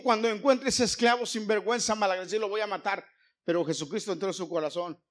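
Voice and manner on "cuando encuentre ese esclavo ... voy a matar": loud vengeful master vowing to kill; angry threat. Acted. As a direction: spit out the threat against the runaway. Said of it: "Filemón dijo".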